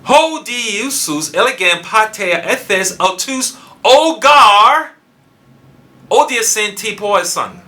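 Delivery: "ogar" punched very high and loud; stress on ogar. Shown as a demonstration. Preacher reading the verse in Greek, heavy emphasis on "ogar". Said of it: "Key emphasis on ogar".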